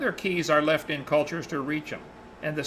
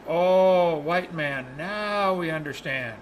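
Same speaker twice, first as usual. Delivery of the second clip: retold dawning realization; much slower than usual. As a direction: stretch it out as if it all suddenly makes sense. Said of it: "And they said".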